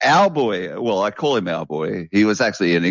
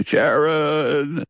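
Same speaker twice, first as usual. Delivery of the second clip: drawn-out higher call of a rambling rocker; slow, raised-pitch mimicked call; 7 semitones higher than usual. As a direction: stretch the name out, calling for the wife. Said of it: "filming him for that Osborne series".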